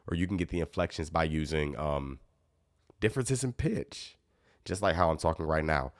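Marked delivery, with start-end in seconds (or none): 3.0-4.2 jumps higher with a rising tune; slow, sing-song rise on pitch; pitch demo. Shown as a demonstration. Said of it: "I'm trying to do differences in pitch".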